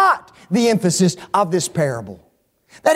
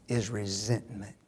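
whispered word; 12 semitones lower, 15 dB quieter than usual. Real, not acted. Preacher to congregation, a low whispered resentment.

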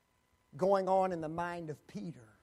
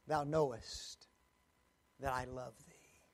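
tender confession; 6 semitones lower, 4 dB quieter than usual. Acted. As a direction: let it sink to a quiet vow.

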